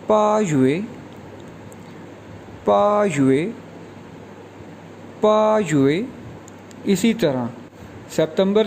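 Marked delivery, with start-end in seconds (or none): 0.1-6.0 पा युए repeated clearly; model pronunciation. Shown as a demonstration. Teacher drilling August as पा युए. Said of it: "Chinese में कहेंगे".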